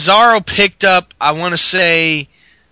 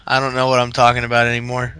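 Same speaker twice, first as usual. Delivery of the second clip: normal talking voice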